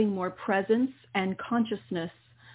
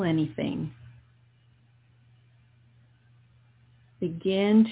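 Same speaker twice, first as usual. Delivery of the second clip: guided meditation. Acted. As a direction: ease the listener into calm breathing. Leads a guided healing meditation.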